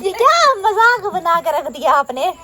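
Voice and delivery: high, laughing complaint; laughing protest. Real, not acted. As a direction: burst out laughing, then protest through it. Laughing at the teasing. (हँसते हुए शिकायत)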